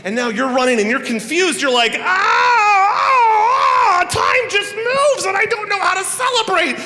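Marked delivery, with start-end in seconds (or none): 2.0-6.8 high, loud crying wail; panicked wail. Pretend. Confused runner wails.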